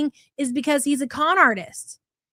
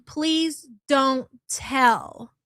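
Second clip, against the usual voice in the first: slow drawn-out quote; much slower than usual. Retold or imitated.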